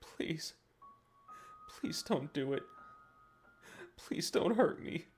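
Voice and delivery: pleading sob. Acted. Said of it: "He was sobbing now".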